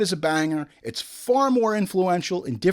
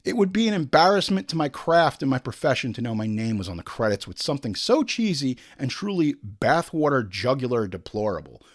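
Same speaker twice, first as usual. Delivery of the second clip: normal talking voice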